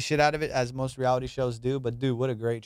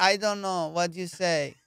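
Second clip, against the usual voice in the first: put-on higher, broken-English foreign accent; a light foreign accent from a bride who doesn't understand; 4 semitones higher than usual. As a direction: play Siri as a confused mail-order bride. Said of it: "Siri is a ninety Day Fiance".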